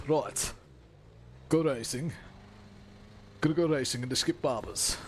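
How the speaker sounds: hammed-up bad Brummie; terrible Birmingham accent. Shown as a demonstration. Streamer rallying viewers to go racing, terrible Birmingham accent. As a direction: put on a deliberately awful Birmingham accent. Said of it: "do the worst Birmingham accent you've ever".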